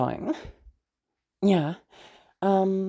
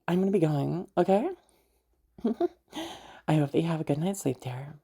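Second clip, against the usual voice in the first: ordinary talk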